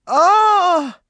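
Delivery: loud, high scream of agony; agonized scream. Acted. Maureen screaming under John's foot. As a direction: scream as he crushes her. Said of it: "she screamed".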